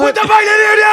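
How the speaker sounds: high, loud yell; mock enraged shout. Imitating dad on phone to Diane, enraged shout. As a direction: explode into screaming outrage.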